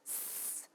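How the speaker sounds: just the sound "S", no letter name. Shown as a demonstration. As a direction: hiss a long "S" sound. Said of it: "that sound".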